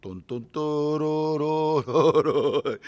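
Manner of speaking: loud booming ship noises; imitated ship guns. Conjuring big warships at Iwo Jima, imitated gun booms. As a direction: sound out the battleships firing. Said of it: "hear the big ships going".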